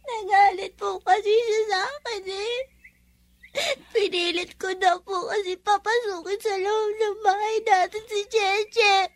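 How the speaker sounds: put-on sobbing child telling what happened; crying, with catches of breath; tearful explaining. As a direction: explain between sobs.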